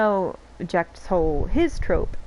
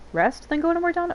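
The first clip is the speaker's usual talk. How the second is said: confused question; 10 semitones higher than usual. Real, not acted. Confused reading quest text.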